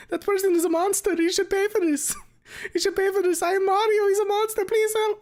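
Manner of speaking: very high, loud cartoon plea for help; Mario. Streamer reads Mario's plea from the fic, squeaky hammed-up distress. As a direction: squeal like a panicked cartoon plumber. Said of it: "he's shouting this out in the hallways".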